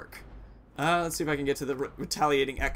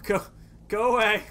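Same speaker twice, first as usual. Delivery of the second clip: panicked shout; 5 semitones higher than usual. Real, not acted. Enemies closing in on him.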